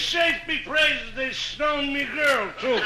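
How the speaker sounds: slow, hammed-up Irish brogue; Irish exclamation. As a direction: drunk Pat wailing in Irish. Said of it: "Irish story".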